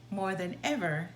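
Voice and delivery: plain reading voice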